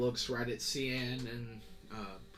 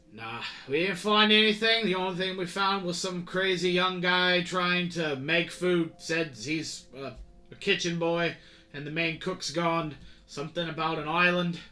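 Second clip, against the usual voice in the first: higher, louder sailor voice rambling a report; chatty navy sailor; 6 semitones higher, 10 dB louder than usual. Acted. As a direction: report to the captain, puzzled and gossipy. Sailor reports search results.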